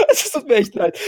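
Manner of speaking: giggling apology; laughing. Real, not acted. Laughs off his burp.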